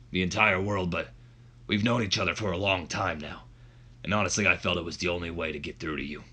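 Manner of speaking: roughly put-on wrestling boss voice; bad Vince McMahon. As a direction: do a poor impression of a pleading boss. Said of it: "this voice is gonna suck".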